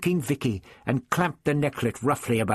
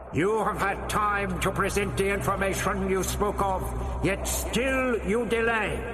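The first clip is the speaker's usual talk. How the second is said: booming alien voice; 9 semitones higher than usual. A narrator's reading. The voice in the dome booms its threat at the Doctor. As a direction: pronounce a sentence like an alien overlord. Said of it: "The voice boomed back".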